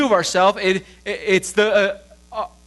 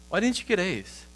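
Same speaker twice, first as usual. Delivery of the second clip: disapproving parent; 8 dB quieter than usual. Acted. Parent questions child's grades.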